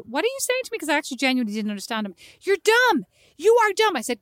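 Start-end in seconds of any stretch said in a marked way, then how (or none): 0.1-0.8 high, baffled question; baffled mom. Retold or imitated. Mother retelling her confused reply to her son, high and baffled. 2.4-4.0 put-on higher, louder kid chant; son taunting. Mother imitating her son's taunt, higher and louder.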